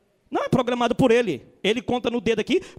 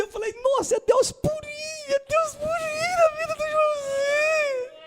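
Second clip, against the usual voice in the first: higher, tearful mock sobbing; mock crying; 12 semitones higher than usual. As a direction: ham up weeping over the sad story. Retelling crying at moving testimony. (choro exagerado de brincadeira)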